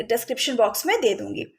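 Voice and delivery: usual speaking voice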